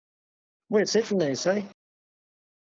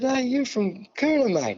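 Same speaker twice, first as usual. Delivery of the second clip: much higher, eager hey to a stranger; a high, friendly hail to a fellow from Cooner; excited greeting; 9 semitones higher than usual. Retold or imitated. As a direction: call out keenly to someone from home.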